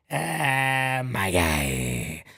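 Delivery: lightly voiced low echo of my guy; mock low voice. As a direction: drop into a silly deep voice. Echoing in a put-on voice.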